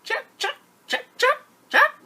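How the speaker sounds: very high, short monkey cries; monkey chatter. Acted. Monkey swinging from trees, high chattering.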